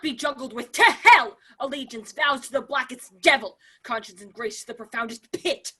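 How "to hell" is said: loud, raging curse; furious outburst. Acted. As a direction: spit it out in defiance. Laertes defies all in rage.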